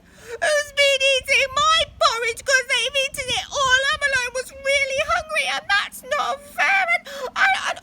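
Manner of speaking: high, loud wailing little bear; mock-tearful high bear voice; Baby Bear crying. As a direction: whine it like a sobbing cub. Baby Bear wails over porridge.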